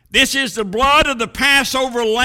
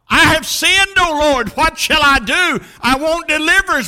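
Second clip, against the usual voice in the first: high, loud plea to the Lord; crying out; 6 semitones higher, 4 dB louder than usual. Acted. Preacher as Job pleading for deliverance, crying out. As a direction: raise the voice in urgent anguish. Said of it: "when he cried out".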